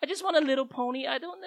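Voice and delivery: put-on high whiny little-kid plea; begging child. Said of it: "when children are trying to beg you".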